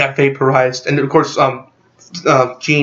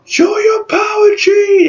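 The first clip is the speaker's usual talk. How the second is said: much higher, slow, straining call; urgent cry to Jean; 4 dB louder, slower than usual. Retold or imitated. Charles urging Jean to unleash her power, high strained cry. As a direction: urge her on at the top of the voice. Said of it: "He's like".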